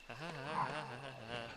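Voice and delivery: string of ha-has; put-on laugh. Player passing the zombie dogs, put-on laugh. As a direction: let out a running cackle.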